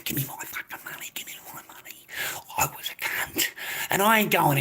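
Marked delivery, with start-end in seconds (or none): 0.0-2.1 whispered demand dying away to nothing; fading whisper. Retold or imitated. 2.6-3.5 whispered aside. Real, not acted.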